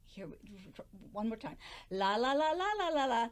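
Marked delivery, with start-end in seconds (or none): none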